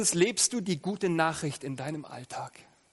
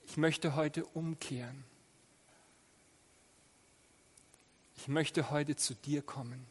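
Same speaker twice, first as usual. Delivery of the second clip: spoken prayer. Acted. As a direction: pray humbly and gently aloud. Leads the congregation in prayer.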